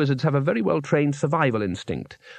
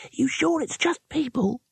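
much higher nervous squeak; nervously; 12 semitones higher than usual. A narrator's reading. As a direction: ask in high fright. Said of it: "said the youngest wizard nervously".

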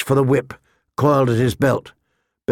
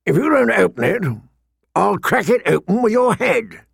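high-pitched, forceful knight's threat; menacing threat; 6 semitones higher than usual. Acted. Knight threatening the seller who refuses him, menacing.

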